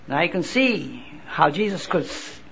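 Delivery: ordinary delivery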